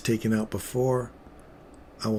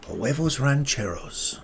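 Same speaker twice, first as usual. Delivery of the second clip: slow, drawn-out relish on huevos rancheros; playful exclamation; 3 semitones higher, slower than usual. Pretend. Trader daydreaming about huevos rancheros, playful drawn-out exclamation. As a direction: savour the dish name theatrically. Playfully calls out dish name.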